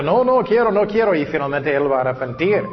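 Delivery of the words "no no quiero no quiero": higher-pitched mock protest; acted refusal. Preacher voicing Paul resisting God, in mock stubborn protest. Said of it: "Y él era".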